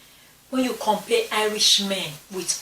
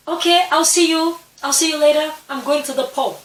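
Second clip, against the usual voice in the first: high, loud call imitating the Irishman; retold parting call; 9 semitones higher, 8 dB louder than usual. Irishman leaving his drunk friend for the pub, imitated loud call. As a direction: call it out high and loud, easing off slightly by the end.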